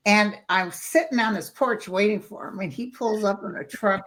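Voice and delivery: ordinary talk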